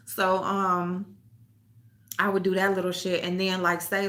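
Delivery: usual speaking voice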